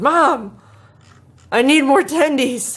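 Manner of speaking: hammed-up cry for mom, pitched high; mock yell. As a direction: yell for mom like a spoiled kid. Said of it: "and I yelled".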